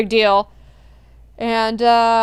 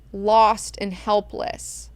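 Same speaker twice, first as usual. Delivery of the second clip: ordinary talk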